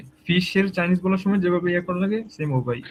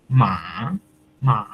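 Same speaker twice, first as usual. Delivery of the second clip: মা dipping down then rising; dipping third-tone মা; 6 semitones lower, much slower than usual. Shown as a demonstration. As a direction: drop মা then lift it. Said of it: "falling এবং rise".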